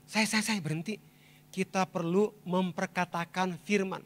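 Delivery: hushed, halting reply, then measured resolve; subdued retelling. Retold or imitated. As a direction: quietly act out stopping your tears. Weeping father retells stopping crying.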